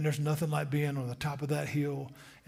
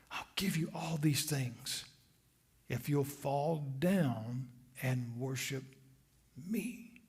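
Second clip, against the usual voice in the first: soft slow whispered bargain; tempter's whisper; 4 semitones lower, 7 dB quieter, slower than usual. Acted. Satan offering the kingdoms to Jesus, slow whisper. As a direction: whisper the deal, drawing it out.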